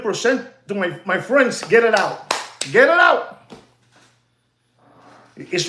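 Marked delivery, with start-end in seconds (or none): 2.7-3.4 punched-up higher repeat; emphatic command. Acted. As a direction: bark the order a second time. Repeats command, second take louder.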